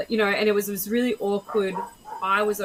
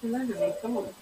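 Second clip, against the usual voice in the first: talking to dog; 2 semitones higher, 6 dB quieter, faster than usual. Real, not acted.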